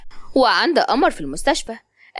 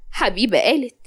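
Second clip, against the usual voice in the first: plain reading voice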